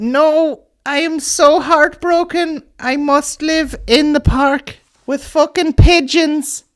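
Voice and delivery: high, slow, dramatic pigeon lady; Kane Toomey's character. Acted. Comic telling the audience, as a high tragic pigeon lady. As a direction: wail it up high and slow like a tragic bird woman. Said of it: "I've turned into Kane Toomey, his wee character".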